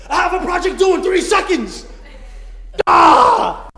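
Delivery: high, loud panicked shriek; hammed-up stressed wife screaming; mock panic. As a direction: blurt it out in a comic panic. Panicked reply to husband.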